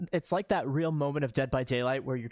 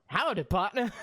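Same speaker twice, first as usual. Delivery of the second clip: mock cowboy greeting; 7 semitones higher than usual. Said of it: "It's so out of place".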